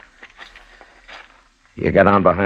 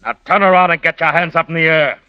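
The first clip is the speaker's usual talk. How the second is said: much higher, shouted orders; commanding shout; 13 semitones higher, 3 dB louder than usual. Acted. Marshal ordering surrendering outlaws to drop guns, shouting. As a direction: bark the commands across the clearing.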